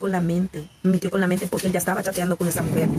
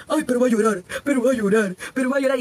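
mock teasing; 3 semitones higher than usual. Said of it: "Y ustedes pueden decir".

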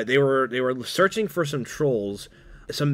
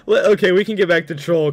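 laughing hard; 8 semitones higher, 8 dB louder than usual. Real, not acted. Cracking up at the meme joke.

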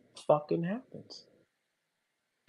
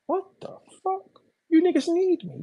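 incredulous; 13 semitones higher than usual. Real, not acted. Incredulous outburst after mock quote.

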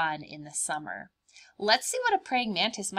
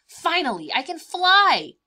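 joyful triumph; 9 semitones higher, 10 dB louder than usual. Acted. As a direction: burst out with joy at flying. Mantis finally flying.

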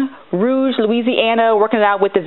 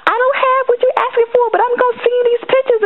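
put-on high, chatty girlfriend quote; sassy girlfriend voice. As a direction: play her friend gushing back, fast at the end. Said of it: "and she was like".

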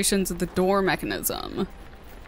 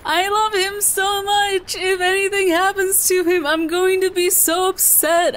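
gushing adoration; 10 semitones higher than usual. Real, not acted. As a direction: gush in a high, doting squeal. Gushing over a beloved character.

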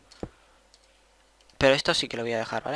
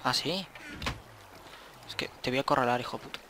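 normal talking voice

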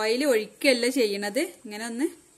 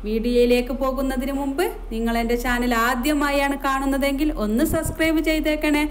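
louder, bright presenter greeting; upbeat vlog intro; 2 semitones higher, 6 dB louder than usual. Acted. Cook welcoming viewers to her kitchen channel, upbeat and loud. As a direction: welcome the viewers like a cheerful host.

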